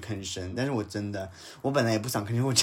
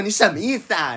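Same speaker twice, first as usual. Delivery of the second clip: laughing retort; 9 semitones higher, 7 dB louder than usual. Retold or imitated. Laughing retelling of the confrontation.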